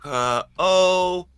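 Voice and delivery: halting, struggling syllable by syllable; sounding out words. Acted. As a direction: sound it out like a poor reader. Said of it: "tries to sound out the words".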